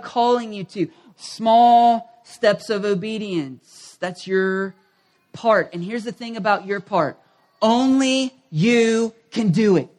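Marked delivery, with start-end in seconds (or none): 1.2-4.0 "Small steps of obedience" drawn out very slowly; slow emphatic refrain. Shown as a demonstration. Preacher repeating his refrain to the congregation, very slow. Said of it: "preaching refrain". 7.6-9.9 "Only" stretched, slow and weighty; stress on only. Shown as a demonstration. Preacher telling listeners only they can act, slow weighty stress. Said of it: "preaching emphasis".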